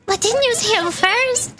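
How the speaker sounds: high, drawn-out sidekick protest; indignant game sidekick. Acted. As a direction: whine back in a high character voice. Voices Paimon's indignant retort.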